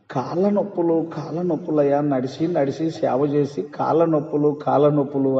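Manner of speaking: soft, low complaint of aching legs; pleading servant moaning to the Lord; whining servant. Retold or imitated. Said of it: "ఆ కలలో ఏడుస్తూ ప్రార్థన చేస్తున్నాడు".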